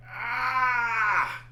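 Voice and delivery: high, far-off roar as the devil rears up; monster roar. Acted. The devil rearing up at the bear, a roaring shout. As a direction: let out a beast's attacking cry.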